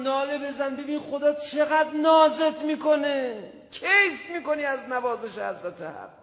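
loud, high impassioned sermon; fervent preaching. Acted. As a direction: preach it with full force to the crowd. Preaching at full force.

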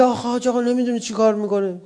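high, rushed mock grievance; exasperated complaint. Newlywed complaining to the cleric about a spouse, exasperated.

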